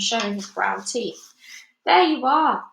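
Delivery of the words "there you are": high, loud told-you-so call; called out triumphantly. A narrator's reading. Police chief calls out, pleased with himself. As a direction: crow at being proven right. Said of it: "called the chief of police".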